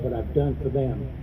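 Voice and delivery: ordinary talk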